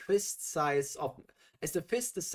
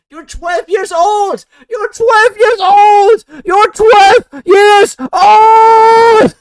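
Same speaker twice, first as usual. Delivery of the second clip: high-pitched screamed outrage, words stretched longer each time; screaming; 24 dB louder, much slower than usual. Real, not acted. Appalled reader screaming at the author over a twelve-year-old character, full-blast scream. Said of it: "screaming into my microphone".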